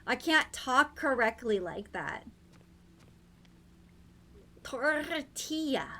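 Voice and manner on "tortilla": "Tortilla" slow, with the R rolled in the throat; rolled R try. Shown as a demonstration. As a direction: stretch out "Tortilla", rolling its R. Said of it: "She's suddenly rolling it".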